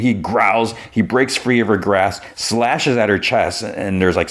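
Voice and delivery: plain reading voice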